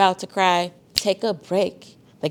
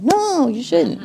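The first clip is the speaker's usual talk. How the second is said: much higher, slow and drawn out; emphatic; 12 semitones higher, much slower than usual. Real, not acted. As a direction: stretch it out to make the point. Teacher answers student emphatically.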